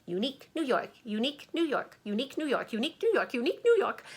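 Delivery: speeding up each time; fast repetition. Shown as a demonstration. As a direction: rattle it off as quick as you can. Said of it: "keep saying it as quickly as you can".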